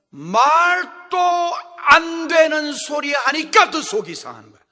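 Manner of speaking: loud preaching punch; emphatic shout. Real, not acted. Preacher to congregation, loud emphatic shout. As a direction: drive the point home loudly.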